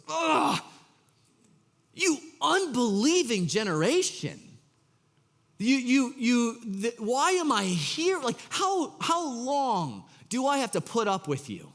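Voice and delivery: high, exasperated groaning complaint; exasperated Jesus. Retold or imitated. Jesus scolding his doubting disciples, exasperated and high. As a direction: sigh it out like a fed-up teacher.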